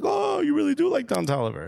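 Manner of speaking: high-pitched mock astonishment; amazed store clerk. Sneaker store dude learning the visitor's taste, mock amazement. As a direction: imitate a clerk's high, surprised realisation.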